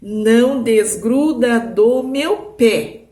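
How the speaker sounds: teacher revealing the hidden sentence slowly; slow decoded line. Shown as a demonstration. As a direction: read out the solved sentence word by word. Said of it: "assim, ó".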